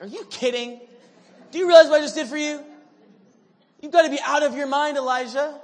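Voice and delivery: loud, high, fast exasperated yell; exasperated shout. Pretend. Pastor yelling at Elijah in mock exasperation, loud and high. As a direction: shout at him in disbelief. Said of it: "I'd be, like, slapping the guy in the head".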